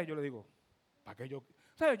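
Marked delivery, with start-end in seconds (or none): 1.0-1.4 low, soft mock-humble reply. Husband answers wife about her purse, mock humble. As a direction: answer meekly, keeping it small. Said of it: "Yo bien humilde".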